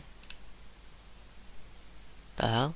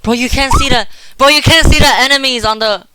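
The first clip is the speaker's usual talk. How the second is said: frantic; 6 semitones higher, 4 dB louder than usual. Real, not acted. Frantic advice during chase.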